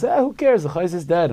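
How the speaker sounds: mock high loud jeer; a sharp, high-pitched mimicry of the boy; mocking the retort. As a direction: toss it off like a cheeky kid. Boy's retort retold by elder.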